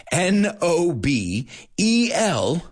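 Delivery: slow, spaced letters with pauses; slow spelling. Shown as a demonstration. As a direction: spell each letter slowly for learners. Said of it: "at a more human pace".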